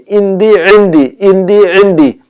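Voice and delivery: इंदी with the ain pushed from the throat, slow; throaty ain. Shown as a demonstration. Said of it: "मैं थोड़ा सा जोर से इसलिए आवाज निकाल रहा हूं". (इंदी को हलक से जोर देकर बोलना)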